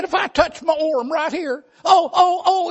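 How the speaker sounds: high, tearful whine about pain; whimpering hypochondriac. Retold or imitated. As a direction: whine like every touch is agony. Arzy moaning in pain.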